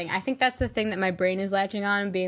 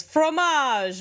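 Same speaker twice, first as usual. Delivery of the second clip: higher, louder mock French sweet-talk; Mooney; 7 semitones higher, 5 dB louder than usual. Imitating a fair performer wooing a lady in French, mock-romantic call. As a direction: call it out as a playful French wooer. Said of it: "he starts talking, like, actual French ... saying very sweet things, and then he goes".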